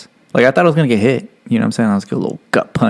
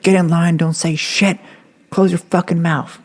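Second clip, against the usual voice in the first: higher barked commands, hammed up; mock drill instructor; 8 semitones higher than usual. Instructors ordering recruits into line, mock bark. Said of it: "everybody's like".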